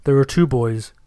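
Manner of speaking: ordinary delivery, nothing marked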